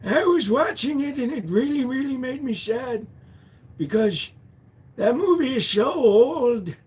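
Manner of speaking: high, whimpering fake cry; hammed-up weepy voice, dragging the last words; mock sad. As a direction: pretend to wail over it. Mock sadness over an old movie.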